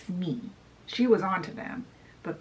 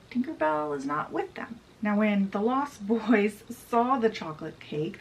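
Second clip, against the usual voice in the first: ordinary delivery, nothing marked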